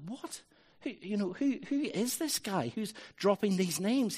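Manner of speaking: high, incredulous questioning; astonished reaction. Retold or imitated. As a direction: act out a reader taken aback. Acted astonished thought on reading.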